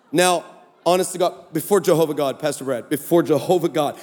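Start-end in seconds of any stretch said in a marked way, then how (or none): none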